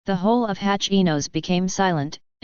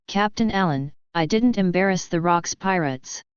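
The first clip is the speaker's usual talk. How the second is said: ordinary delivery, nothing marked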